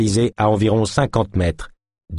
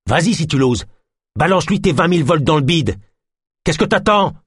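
taunting yell; 8 semitones higher than usual. Acted. Philémon dares Ganesh to shoot him, taunting yell. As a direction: goad him to fire.

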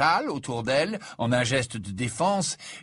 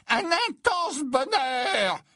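very high, slow, disdainful drawl; disdainful seer; 13 semitones higher, much slower than usual. Acted. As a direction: sniff at the idea of happiness. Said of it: "avec dédain".

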